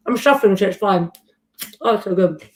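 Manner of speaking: talking with mouth full; eating chocolate. Real, not acted. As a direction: speak around a bite of eclair. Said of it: "have a bite of my chocolate eclair".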